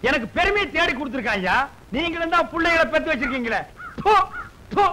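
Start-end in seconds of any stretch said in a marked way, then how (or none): 4.0-4.8 short, sharp spit; spitting in scorn. Acted. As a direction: spit at the listeners twice. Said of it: "காரி துப்புறியா". (காறித் துப்பும் சத்தம்)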